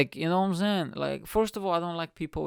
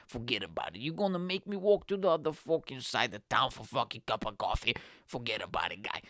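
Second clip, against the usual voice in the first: put-on lightly done New York accent, lower, dismissive; a hint of a New York podcaster brushing it off; 4 semitones lower, 3 dB quieter than usual. Comedian mocks his date in a light New York accent. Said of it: "watching a lot of New York-based podcasters".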